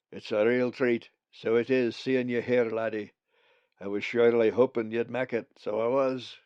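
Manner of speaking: put-on a jolly old Scots bird greeting the lad; Scottish brogue. A narrator's reading. Bugs the raven speaks.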